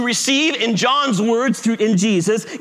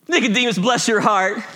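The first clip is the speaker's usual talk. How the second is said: put-on southern accent. Imagined evangelical Jesus answering Nicodemus, southern accent. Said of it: "Jesus has a southern accent".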